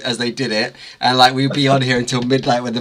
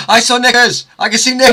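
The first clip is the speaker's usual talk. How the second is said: high, loud excited squeal; startled high squeal at something seen; 11 semitones higher, 6 dB louder than usual. Real, not acted. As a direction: shriek it out in delighted shock. Spotting knickers on stream, excited.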